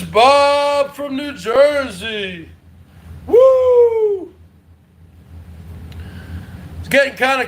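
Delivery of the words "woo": high loud celebratory whoop. Real, not acted. Streamer cheering a donor, high loud whoop. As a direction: let out a big cheering woo.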